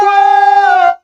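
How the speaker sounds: loud, high startled whoa; surprised shout. Real, not acted. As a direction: yell in shock at the screen. Reacting to a surprise.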